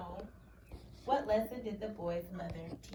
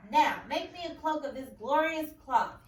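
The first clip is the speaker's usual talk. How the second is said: a lightly voiced royal order, louder; 4 semitones higher, 9 dB louder than usual. King ordering a cloak from the boy, mildly louder. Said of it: "he ordered".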